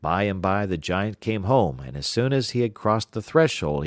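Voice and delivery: plain reading voice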